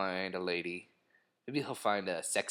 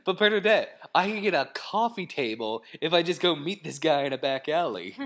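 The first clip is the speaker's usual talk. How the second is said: high, loud hammed-up protest; mock pleading; 7 semitones higher, 6 dB louder than usual. Husband mock-arguing for a Craigslist coffee table, high pleading. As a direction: plead in a put-on higher voice. Acted argument with cautious partner.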